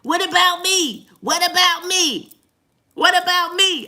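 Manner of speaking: high, slow whiny plea; mock whine for the dog; dog's needy voice. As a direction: voice the barking dog begging for attention. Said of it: "Sydney started barking".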